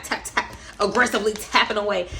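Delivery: normal talking voice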